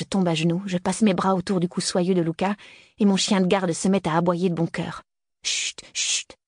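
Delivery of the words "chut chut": soft unvoiced shush to calm the dog; breathy urgent shushing; whispered hush. Hushing the barking dog.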